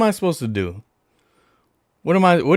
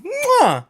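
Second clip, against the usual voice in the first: blown kiss. Real, not acted. Blowing a kiss to the player.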